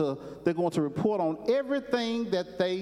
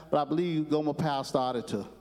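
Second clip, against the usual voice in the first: ordinary delivery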